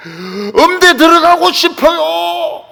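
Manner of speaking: slow, high, hollered wish; demonstrating a student's cry to God; shouted prayer. Shown as a demonstration. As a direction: stretch it into a loud pleading yell. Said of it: "왜 소리를 안 지르냐".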